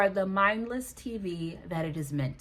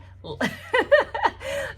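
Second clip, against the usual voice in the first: high, bursting laugh; real laughing fit; 5 dB louder than usual. Real, not acted. Vlogger tickled by muddling a product name, high helpless laugh. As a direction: crack up at your own mistake.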